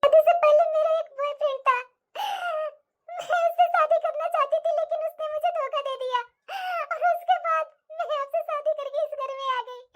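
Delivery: tearful, sobbing admission to a husband; crying confession. Acted. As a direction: confess and plead through sobs. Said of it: "तू रो क्यों रही है". (रोते हुए, सिसककर)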